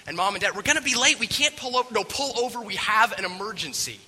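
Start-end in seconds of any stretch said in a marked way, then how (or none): 0.5-1.9 loud, high, harried protest; stressed parent shouting back; frantic refusal. Acted. Parents refuse amid panic. 1.9-4.0 high-pitched, emphatic demand; alarmed, raised-voice plea; urgent insistence. Acted. Kids insist on stopping.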